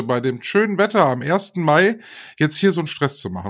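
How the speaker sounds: ordinary talk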